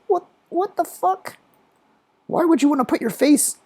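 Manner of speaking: mock-baffled high-pitched questioning; baffled store clerk. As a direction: imitate a bewildered clerk way up high. Voices baffled tech store staff.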